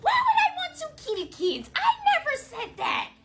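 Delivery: high squeaky mock teacher voice, half laughing; shrill impression of a teacher denying it. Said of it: "And she's like".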